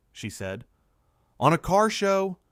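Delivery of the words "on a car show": loud, high incredulous exclamation; raised-voice disbelief; exclaiming. A narrator's reading. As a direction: burst out in protest, pitch jumping. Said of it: "Jeremy exclaimed".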